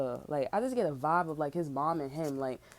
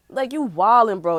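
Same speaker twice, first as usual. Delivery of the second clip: loud, disbelieving call-out; shouting you're out of line; outraged shout; 4 semitones higher, 13 dB louder than usual. Real, not acted. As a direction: yell it in disgust. Ranting at the mother.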